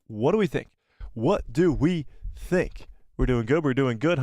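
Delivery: usual speaking voice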